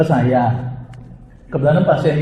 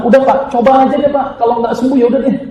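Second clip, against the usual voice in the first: pleading urge; 9 semitones higher, 4 dB louder than usual. Retold or imitated. Doctor urges patient to try.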